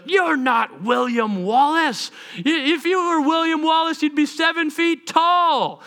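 high, drawn-out heckle; doubting soldier. Retold or imitated. Warrior challenging William Wallace, incredulous high call.

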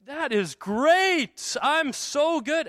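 high, very slow and self-satisfied; a cocky young preacher gloats over his first sermon; smug boast. Retold or imitated. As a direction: savor every word like a proud rookie. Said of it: "I left that first sermon thinking".

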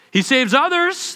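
high sneering taunt; mocking priests. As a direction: scorn him as you quote. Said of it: "the elders mocked him".

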